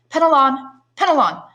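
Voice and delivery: high, loud call across the garden. A narrator's reading. Julie calls the old sailor across the garden, loud and high. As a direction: hail him from afar.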